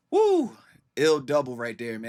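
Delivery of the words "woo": excited whoop. Real, not acted. Hyped by the verse.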